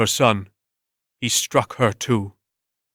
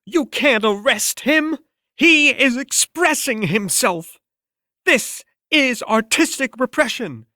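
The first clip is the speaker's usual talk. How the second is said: indignant art critic; 13 semitones higher than usual. Acted. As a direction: shriek at the police in lofty indignation. Angry mob of art critics.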